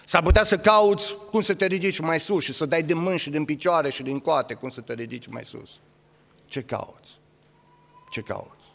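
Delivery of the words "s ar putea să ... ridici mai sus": ordinary delivery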